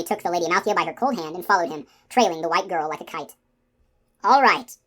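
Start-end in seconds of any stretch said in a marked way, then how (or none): none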